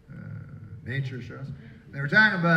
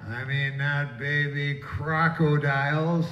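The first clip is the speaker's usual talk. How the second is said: slow sing-song list; slower than usual. Pretend. List drawn out, partly sung.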